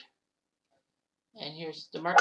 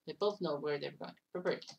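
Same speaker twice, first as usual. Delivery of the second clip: ordinary talk, nothing marked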